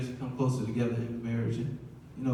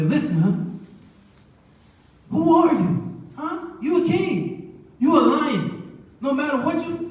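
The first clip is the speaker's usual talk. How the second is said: much higher, hyped encouragement; mock pep talk; 11 semitones higher, 4 dB louder than usual. Wife hyping up her husband before work, high mock pep talk. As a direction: pump him up like a coach.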